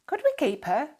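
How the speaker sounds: put-on a boy begging to keep the cat; young boy asking Dad eagerly to keep the cat, excited. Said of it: "Billy asked excitedly".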